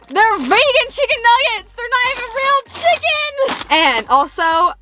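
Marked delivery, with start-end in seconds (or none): 0.1-3.7 shocked realization. Real, not acted. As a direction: pitch jumps up in disbelief. Discovering the nuggets are fake.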